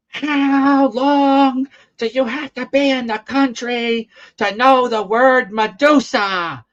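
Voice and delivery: high, loud, drawn-out mock tirade; imitating angry yelling. Nasty woman scolding a poor boy, mock yelling. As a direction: yell it slowly like an outraged scold. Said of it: "I hear her yelling at this poor boy".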